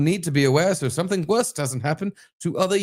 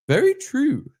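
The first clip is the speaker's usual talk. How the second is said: put-on English accent; 12 semitones higher than usual. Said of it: "I'll do the English accent for the girl".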